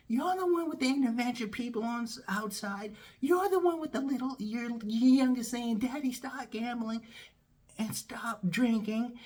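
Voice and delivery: high-pitched, exasperated rant; angry tirade. Retold or imitated. Employee berating the boss who called, angry.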